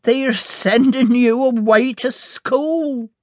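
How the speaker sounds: voix haute et lente, au bord des larmes; sobbing. A narrator's reading. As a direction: plainte lente, la gorge serrée de chagrin. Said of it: "she sobbed".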